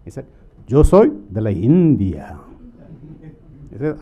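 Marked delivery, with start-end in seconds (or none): 0.7-2.4 loud, drawn-out Spanish in a mock crook's accent; different accent. Crook telling his seatmate where he is from, mock accent. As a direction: put on a booming foreign-accented Spanish. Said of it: "talks in a different accent".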